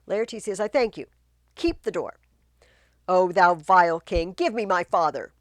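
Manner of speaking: loud, commanding fury; furious Laertes. Acted. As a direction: storm in demanding the king. Laertes storms in.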